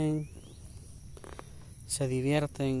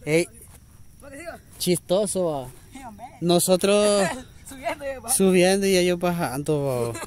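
excited; 4 semitones higher, 6 dB louder than usual. Real, not acted. Excited calling out, laughing.

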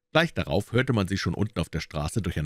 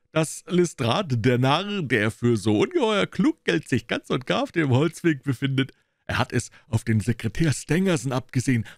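higher, louder, mocking; gloating detective; 8 semitones higher, 3 dB louder than usual. A narrator's reading. Gregson mocking Lestrade to Holmes and Watson. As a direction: sneer at the rival's folly.

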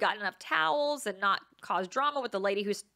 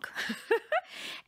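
airy giggle; 13 semitones higher than usual. Real, not acted. Admits doing everything wrong.

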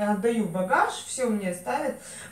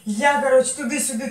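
ordinary delivery

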